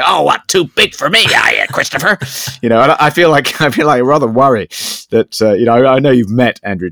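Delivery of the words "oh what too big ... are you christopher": mock snide high jab; sneering like a spurned fan; a fan turning nasty, mock sneer. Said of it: "suddenly get nasty and say".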